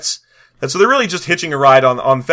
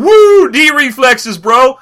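high, loud party-bro whoop; 9 semitones higher, 8 dB louder, much slower than usual. Acted. Voicing the dwarf with tomahawks, rowdy bro whoop. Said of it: "he's just standing there going".

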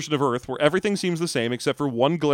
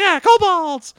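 high, loud cackling kobold cheer; 8 dB louder, much slower than usual. Pretend. Cohost joins the bit voicing a cheerful kobold, high cackling cheer. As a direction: squeal it out like a gleeful little kobold. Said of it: "high-pitched cackle accent for kobolds".

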